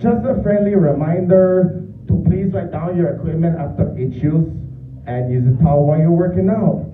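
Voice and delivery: gym announcement in a put-on Mexican accent; hammed-up Mexican accent. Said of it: "I call this one my Mexican accent".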